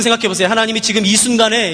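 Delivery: ordinary talk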